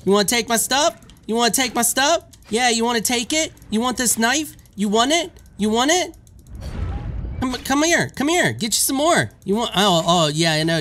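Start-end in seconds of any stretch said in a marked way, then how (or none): 0.0-9.7 high, rising taunts at a game enemy; aggressive taunting. Real, not acted. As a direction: goad the thief with high-pitched challenges. Taunting the thief while attacking.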